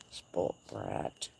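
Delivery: very low, soft, gravelly mock voice on "Spoilt brat"; mock deep growl. Teasing her spoiled horse in a mock deep growl.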